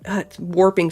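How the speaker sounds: normal talking voice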